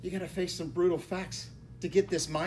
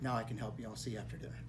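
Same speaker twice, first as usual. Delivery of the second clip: quiet calm reply; 7 semitones lower, 10 dB quieter, much faster than usual. Retold or imitated. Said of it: "and he goes".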